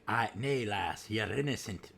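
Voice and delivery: a hint of a gruff old pirate; mock pirate, barely shifted; horrible pirate voice. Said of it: "It's my horrible pirate voice".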